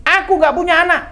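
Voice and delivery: acted high, loud outburst; angry disowning. Mother disowning her sick son, acted angry shout.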